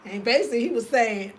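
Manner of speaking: ordinary delivery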